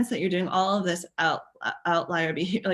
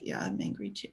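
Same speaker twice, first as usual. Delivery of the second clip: put-on whispered, much lower kid admitting it; soft admission; 11 semitones lower, 9 dB quieter than usual. Softened child admits anger to parent, whispered.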